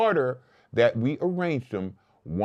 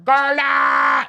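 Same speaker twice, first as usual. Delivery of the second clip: shout; 14 semitones higher, 7 dB louder than usual. Real, not acted. Shouting out a name.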